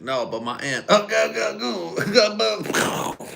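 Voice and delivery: high, loud hammed-up "go, go" noises; mock explosion noises. Retelling the aunt story, high mock explosion noises. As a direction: squeal it like a cheering explosion. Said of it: "Just making explosion noises and shit".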